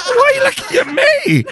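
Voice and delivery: high-pitched squeal through laughter; laughing mock protest. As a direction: protest while cracking up. Mock offence, laughing at co-host.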